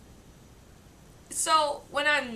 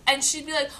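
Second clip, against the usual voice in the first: ordinary delivery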